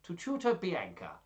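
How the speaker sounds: put-on old-story suitor making a proclamation; Gremio. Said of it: "Gremio in his third voice of the story".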